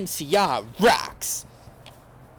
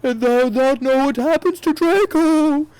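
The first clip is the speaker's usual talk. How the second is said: high, loud, menacing voice; higher, louder sneering dark lord; cruel villain voice; 8 semitones higher, 6 dB louder than usual. A narrator's reading. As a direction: taunt her cruelly. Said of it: "he shouted".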